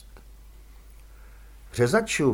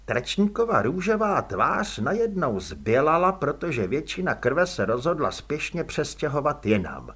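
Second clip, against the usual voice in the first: usual speaking voice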